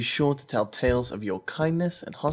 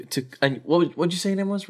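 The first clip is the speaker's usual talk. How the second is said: ordinary delivery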